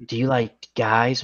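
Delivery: a hint of a soft, seductive recorded voice; sultry phone line. Dialing the warranty number reaches a sex line, soft come-on.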